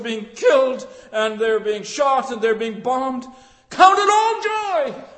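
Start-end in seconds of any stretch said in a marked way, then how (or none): 3.7-5.0 high, slow ringing cry; preaching shout. Real, not acted. Preacher urging the persecuted faithful, shouting. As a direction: proclaim it at full force.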